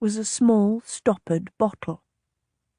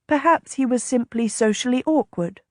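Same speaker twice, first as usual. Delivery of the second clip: plain reading voice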